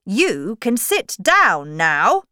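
slow, loud dismissal; mildly angry king. Acted. King dismissing the Hatter, mildly angry and loud. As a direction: dismiss him slowly and firmly. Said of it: "the king said angrily".

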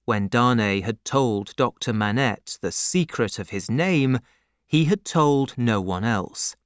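ordinary delivery, nothing marked

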